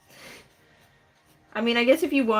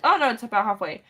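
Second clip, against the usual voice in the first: ordinary delivery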